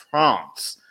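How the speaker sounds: "France" with an AH vowel, as if spelled Frohnce; France said as "Frahnce". Shown as a demonstration. Demonstrating his name after spelling it, "France" said as Frahnce. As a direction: say "France" as "Frahnce", not like "dance". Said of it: "it's pronounced".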